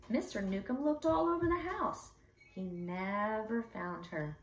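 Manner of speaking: usual speaking voice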